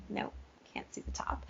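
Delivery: whispered aside to herself; muttered aside. Real, not acted. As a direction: mutter it under your breath.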